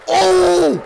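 loud, high yelp of triumph; excited shout. Real, not acted. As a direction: whoop like a big win. Big hit in game.

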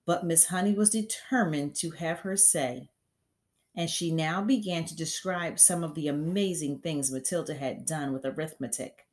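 ordinary delivery, nothing marked

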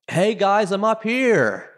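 high-pitched call down from upstairs; retold yell from above. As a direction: imitate someone hollering down to visitors. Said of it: "suddenly yell out saying".

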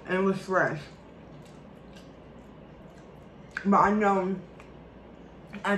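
words muffled by mashed potatoes; talking while chewing. Real, not acted. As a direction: mouth full of food. Seen 0: puts forkful of mashed potato in mouth, then talks chewing.

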